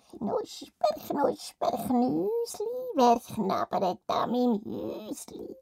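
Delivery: high, slow, eerie witch croon; sing-song it like a lurking witch. Acted. The witch calling from her gingerbread house. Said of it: "Schon wieder".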